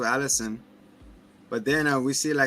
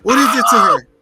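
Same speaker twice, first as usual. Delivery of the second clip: high, very loud hammed-up outrage; mock accusing shout; 8 semitones higher, 13 dB louder, faster than usual. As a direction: yell it like an outraged parent. Repeats accusing parent, full volume.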